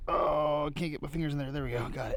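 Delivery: strained and hushed, reaching down into a hole; breathy effort while grabbing the find; breathless treasure hunter. Real, not acted.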